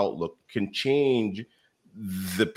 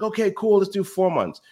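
much higher, bright eager agreement; eager yes; 13 semitones higher, 4 dB louder than usual. Retold or imitated. Telling his team he's doubling their time off, eagerly. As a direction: say yes like it's the best idea ever.

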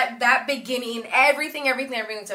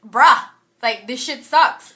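a loud, bratty dismissal; mock loud complaint; 3 semitones higher, 11 dB louder, slower than usual. Imitating Hetty scoffing at the plane design, loud and dismissive. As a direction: blurt it out loud like a fed-up teenager. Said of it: "Hetty was like".

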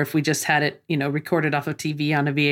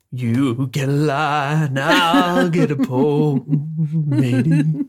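Neville impression; 2 semitones lower, 3 dB louder, much slower than usual. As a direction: drawl it like a salty sailor. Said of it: "variation of Neville going".

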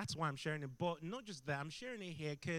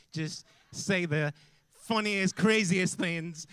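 louder, slower, stretching the funniest and craziest; loud enthusiastic praise; 3 semitones higher, 12 dB louder, much slower than usual. Real, not acted. Preacher praising Nita to the church: loud, slow, delighted. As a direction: beam it out to the whole room.

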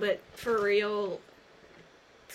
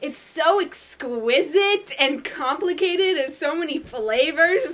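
fancy sales pitch; 5 semitones higher, 8 dB louder than usual. Retold or imitated. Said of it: "You're like".

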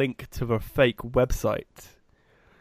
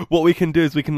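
normal talking voice

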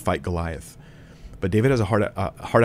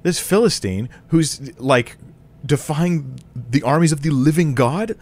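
indignant David; 6 semitones higher, slower than usual. Retold or imitated. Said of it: "He's like".

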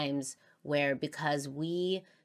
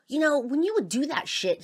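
raised, higher voice replaying his accusation; retold angry confrontation; 11 semitones higher, 6 dB louder than usual. Retelling him blowing up at her, raised and higher. Retold quote from a huge argument.

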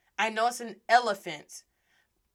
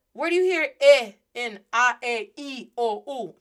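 ordinary delivery

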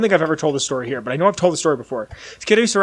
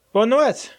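mock French; 6 semitones higher than usual.

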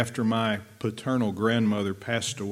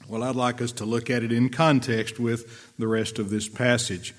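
ordinary talk

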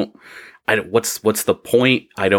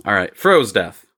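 ordinary talk, nothing marked

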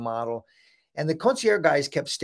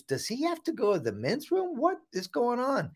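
puzzled aside; 11 semitones higher, 4 dB quieter than usual. Retold or imitated. Puzzled judge asks mom about him.

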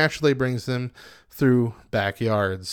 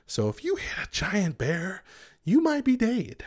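dramatic warning; 7 semitones higher than usual. Acted.

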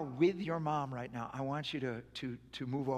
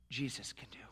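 whisper; 4 semitones lower, 6 dB quieter than usual. Real, not acted. Pastor hushes to a whisper.

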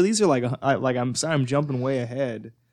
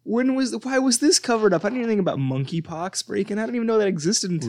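high-pitched, alarmed disbelief; incredulous outburst; 9 semitones higher than usual. Real, not acted. Co-host just learning of a monkeypox outbreak, incredulous and high. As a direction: demand answers, voice shooting up in disbelief.

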